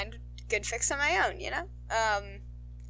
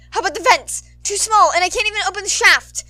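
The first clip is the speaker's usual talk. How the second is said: panicked; 10 semitones higher, 12 dB louder than usual. Acted. Trapped board member panicking.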